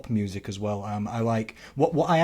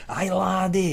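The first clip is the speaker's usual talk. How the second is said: high Scottish lilt; Scottish accent; 6 semitones higher, slower than usual. Acted. Answering the coach as a film Scot, Scottish accent. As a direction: put on a hearty Scots brogue. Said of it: "that's a pretty good accent".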